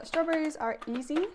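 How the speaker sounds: an Australian-sounding cook briefly goes American on strawberries; sudden American accent. Real, not acted. A vlogger chopping fruit slips into an American accent. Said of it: "I don't know why I just sounded American".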